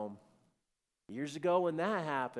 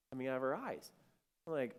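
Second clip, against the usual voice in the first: normal talking voice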